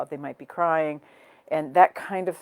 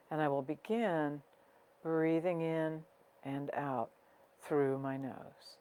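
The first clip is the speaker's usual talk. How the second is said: guided meditation. Real, not acted. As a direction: guide them gently inward, unhurried, letting each phrase settle. Leads a breathing meditation.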